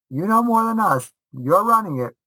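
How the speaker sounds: high, mock-serious parent voice; imitating his parents handing over the Seder; parents telling him.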